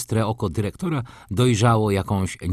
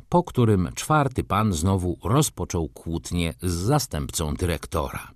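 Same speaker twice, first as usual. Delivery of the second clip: ordinary delivery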